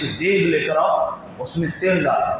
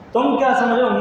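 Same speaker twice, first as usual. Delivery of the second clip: raised challenge; 7 semitones higher than usual. Acted. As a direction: throw the question back at him. Companion answering the Roman commander.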